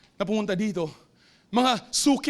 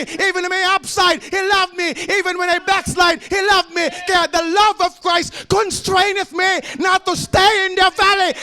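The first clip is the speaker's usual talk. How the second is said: loud, rising preacher's cry, louder each line; fiery preaching; 8 semitones higher, 8 dB louder, slower than usual. Acted. Pastor preaching to the congregation, full-force shouting.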